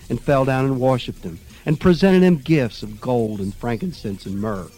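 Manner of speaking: ordinary delivery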